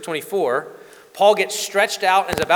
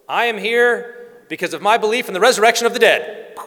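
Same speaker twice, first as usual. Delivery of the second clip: higher and louder declaration; Paul stirring the council, higher and louder; bold proclamation; 7 semitones higher, 6 dB louder than usual. Acted. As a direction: throw it out to the whole room.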